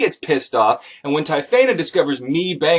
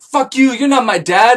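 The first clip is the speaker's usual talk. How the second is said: high, loud bratty retort; mock angry teen; 8 semitones higher, 6 dB louder than usual. Ace yells at the narrator like a sulky teen. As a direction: snap back like a sulky teenager.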